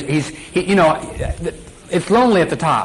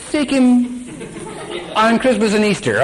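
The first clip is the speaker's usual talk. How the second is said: higher, laughing sarcastic twist; mocking distorter; 7 semitones higher than usual. Preacher mocking holiday-only faith, higher and laughing. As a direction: sneer it with a laugh.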